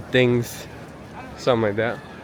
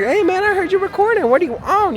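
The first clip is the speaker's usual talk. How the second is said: friendly stranger; 5 dB louder than usual. Retold or imitated. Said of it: "And then he was like".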